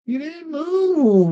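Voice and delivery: slow, high mock whine; high teasing voice.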